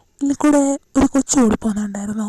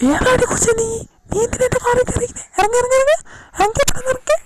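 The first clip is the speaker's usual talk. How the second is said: much higher, fast, frantic; panicked man; 11 semitones higher, faster than usual. Acted. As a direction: shoo her out in a high panic. Panicked man finds girl in car. (പേടിച്ചരണ്ട, ഉയർന്ന സ്വരത്തിൽ)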